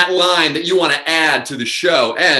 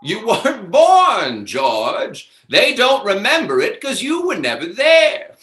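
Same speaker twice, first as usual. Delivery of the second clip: slow, high, theatrical delivery of a film line; dramatic line reading; 6 semitones higher, slower than usual. Acted. Host feeding the actor a line, theatrical and high. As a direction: deliver it like a big dramatic movie moment. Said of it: "what's a good line?".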